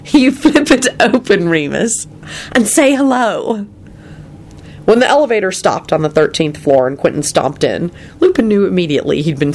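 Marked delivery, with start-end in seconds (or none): 0.1-3.7 amused Elizabeth. A narrator's reading. She was laughing.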